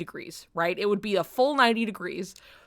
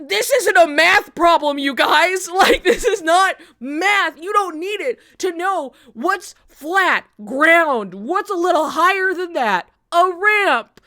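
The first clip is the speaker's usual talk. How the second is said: louder, slower, exasperated; exasperated loud rant; 6 semitones higher, 8 dB louder than usual. Retold or imitated. Telling the group it isn't math, loud and exasperated. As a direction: lecture them, fed up. Scolding friends about math.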